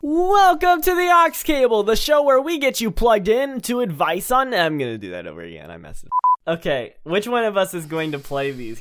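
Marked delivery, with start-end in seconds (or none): 0.0-4.5 high, loud, stretched-out announcer; show announcer. Acted. Welcoming listeners to the show, hyped announcer. Botched show intro, then redone.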